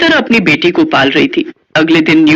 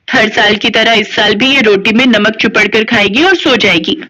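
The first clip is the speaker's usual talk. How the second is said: ordinary delivery, nothing marked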